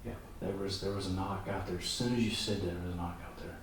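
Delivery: quiet, tense murmur; hushed voice. Real, not acted. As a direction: keep it low so the spirit might answer. Hushed during the ghost hunt.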